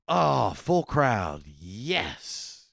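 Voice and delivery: loud drawn-out "oh", savoring yes; relieved delight. Retold or imitated. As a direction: stretch it out, basking in relief. Acted relief at full crowd.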